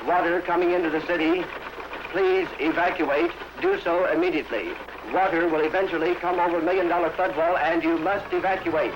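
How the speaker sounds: archival loudspeaker warning; urgent public address call. Real, not acted. Flood warning over loudspeaker.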